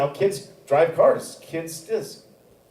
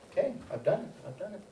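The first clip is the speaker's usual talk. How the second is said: relieved quiet; 3 semitones higher, 14 dB quieter, faster than usual. Retold or imitated. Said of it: "and I'm like".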